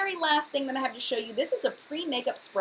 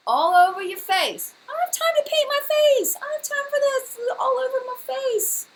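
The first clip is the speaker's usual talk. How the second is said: very high, loud whiny put-on voice; 6 dB louder than usual. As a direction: whine it like a spoiled complainer. Mocking lazy self, high put-on voice.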